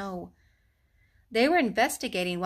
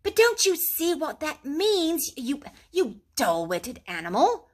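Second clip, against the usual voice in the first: high, slow exasperated cry; impatient; 7 semitones higher, 4 dB louder than usual. A narrator's reading. Rat scolds dull-witted Mole, impatiently. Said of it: "cried the rat impatiently".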